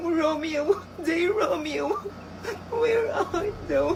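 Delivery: soft tearful calling. Acted. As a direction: cry out softly for Romeo. School play, crying for Romeo.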